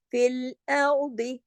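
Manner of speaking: फिल अर्दी slow, with the heavy letters full and thick; heavy letters recited. Shown as a demonstration. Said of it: "ये दो लफ्ज मोटे पढ़े जाएंगे".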